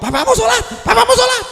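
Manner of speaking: very high, loud scolding; mock mother yelling. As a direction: imitate a mum out-shouting her child. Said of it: "suarami ngungkuli anakmu".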